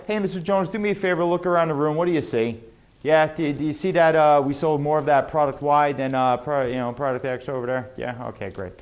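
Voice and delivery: mumbled-through, offhand sales talk; no tonality. Shown as a demonstration. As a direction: toss it off casually, without emphasis. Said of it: "there's no tonality".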